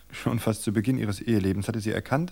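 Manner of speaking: ordinary delivery, nothing marked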